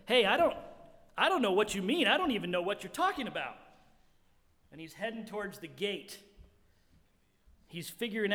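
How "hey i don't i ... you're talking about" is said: higher, louder, hurried fake innocence; playing dumb. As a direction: act clueless while edging away. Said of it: "He's saying this".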